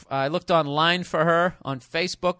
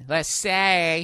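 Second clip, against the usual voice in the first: Saturday with the consonants swallowed; Boston accent; slower than usual. Shown as a demonstration. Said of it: "as they say in Boston".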